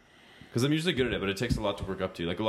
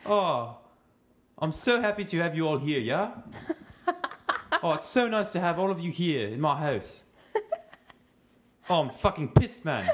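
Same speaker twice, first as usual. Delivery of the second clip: high, gushing South African host; hammed-up South African accent, pitched up; Stephen Hudson; 8 semitones higher than usual. Impersonating him welcoming guests, high South African accent. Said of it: "What else does he say?".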